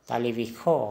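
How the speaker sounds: तालिबिखो with a clear kh where सो is written; kh not s. Shown as a demonstration. Teacher shows learners the Assamese kh sound. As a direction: say तालिबिखो, khо at the end. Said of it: "लेकिन उच्चारण होगा तालिबिखो".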